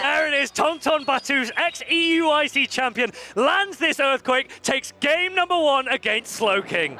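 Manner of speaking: loud, high and slow winning call; excited play-by-play. Real, not acted. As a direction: call the winning moment with full hype. Match-winning moment called out.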